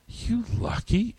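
a hint of a weary stranger's envy; lightly voiced tired businessman; retold stranger. Stranger's parting remark retold.

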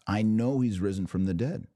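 ordinary talk, nothing marked

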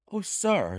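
high, quivering exclamation; emotional cry. A narrator's reading. Ryder desperate for news of the goose, emotional cry. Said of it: "he cried".

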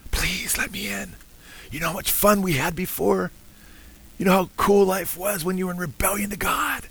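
high, hushed, coaxing stage whisper; tempting whisper. Acted. Paralyzed old man luring the believer back, tempting whisper. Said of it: "he still is able to whisper to us".

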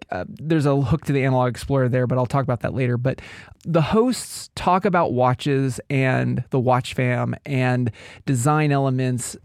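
ordinary talk, nothing marked

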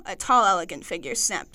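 ordinary talk

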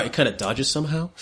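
normal talking voice

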